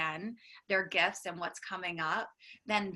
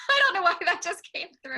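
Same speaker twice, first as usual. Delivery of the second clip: laughing; 12 semitones higher, 9 dB louder, faster than usual. Real, not acted. Laughing at own hairball remark.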